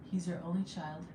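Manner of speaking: usual speaking voice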